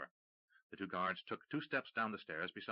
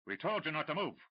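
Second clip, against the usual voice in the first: louder, higher shouted warning; 7 semitones higher, 3 dB louder than usual. Acted. Duncan shouting at the smugglers from the dark. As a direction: shout from the fog. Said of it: "Duncan's voice shout".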